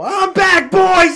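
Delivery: high, full-volume retold announcement; big, high celebratory yell; triumphant shout. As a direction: holler it to the squad on your return. Announcing his return to teammates.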